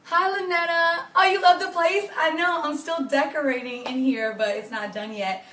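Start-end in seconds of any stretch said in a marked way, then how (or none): none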